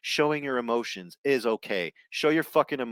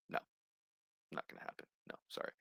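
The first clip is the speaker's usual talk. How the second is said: whispered aside; 22 dB quieter, much faster than usual. Real, not acted. Aside after laughing.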